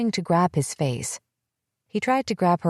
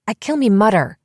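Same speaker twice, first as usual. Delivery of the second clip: put-on higher, louder yell in a thick accent, punching KILL; furious yell of a confession; 5 semitones higher, 6 dB louder than usual. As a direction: shout it out so no one can mistake it. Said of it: "she yelled".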